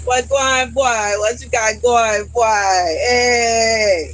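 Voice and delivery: mock Jamaican greeting. Mock Jamaican greeting after Nick joke.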